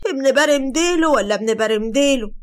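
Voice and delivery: high, loud sarcastic question; acted incredulous jeer; puzzled mockery. A narrator's reading. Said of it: "بصيت له باستغراب وقالت".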